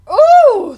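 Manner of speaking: high, loud wailed "ooh"; anguished wail. A narrator's reading. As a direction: wail in helpless dismay. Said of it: "the bell ringer wrung his hands".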